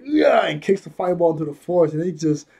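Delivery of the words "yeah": high, loud burst of yeah; hyped yeah. Retold or imitated. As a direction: shout it like a kick landing. Shinra kicks the fireball back.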